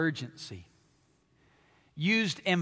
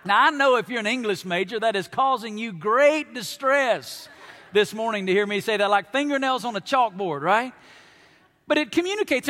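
usual speaking voice

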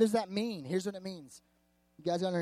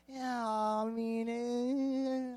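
long drawn-out, higher noncommittal waffle; hedging; 5 semitones higher, 4 dB quieter, much slower than usual. Acted. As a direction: stretch it out, reluctant to admit it. Acted excuse for a bad movie.

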